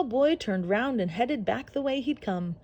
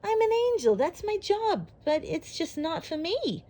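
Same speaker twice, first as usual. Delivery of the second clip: much higher, whiny angel voice; complaining angel; 9 semitones higher than usual. Acted. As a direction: moan like a fed-up angel.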